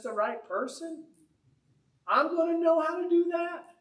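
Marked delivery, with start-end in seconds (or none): 2.1-3.7 high-pitched rhetorical disbelief; scoffing, pitched-up question; incredulous question. Real, not acted. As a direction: ask it high, as if it's absurd. Rhetorical question mocking his own ability.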